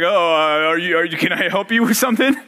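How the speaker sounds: put-on voice, hesitant offer of help; disguised voice. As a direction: pretend to be a stranger, hiding your own voice. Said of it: "he disguises his voice".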